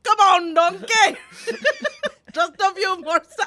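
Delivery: loud, high, badly faked ogre accent; Shrek. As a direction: laughingly attempt a cartoon ogre's call. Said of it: "I can't do his accent at all".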